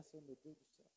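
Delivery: frightened breathy prayer. Retold or imitated. Boy praying under his breath as shots ring out, hushed. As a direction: pray under your breath in fear. Scared silent prayer during gunshots.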